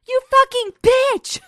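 high, drawn-out, very loud shout; hammed-up outraged yelling; furious yell. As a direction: scream the insult with all you have. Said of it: "I yelled at her".

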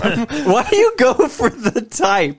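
high, drawn-out complaint through laughs; mock dude whine. Friends riffing on the Lebowski actor, mock dude whine.

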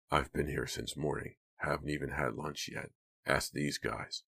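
calm, lightly voiced denial; Hank denying. A narrator's reading.

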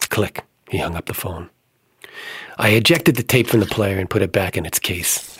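usual speaking voice

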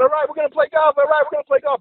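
high-pitched, gleeful chant; excited golfers. Acted. Golfers jumping up and down at vacation news, excited high cheer. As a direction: repeat it like overjoyed buddies bouncing around. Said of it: "They're jumping up and down".

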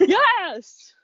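high, loud burst of delight; excited yes. Retold or imitated. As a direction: shout it with sudden glee. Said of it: "I'm like".

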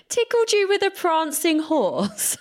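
high, amused echo through laughter; laughing disbelief. Real, not acted. Co-host repeats the prancing-horse line, laughing in disbelief. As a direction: laugh as you repeat her odd phrase back.

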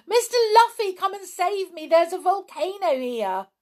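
high, loud frantic cry; screaming in relief. A narrator's reading. Girl screaming to Mister Luffy for rescue, frantic. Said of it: "Anne screamed to him in relief".

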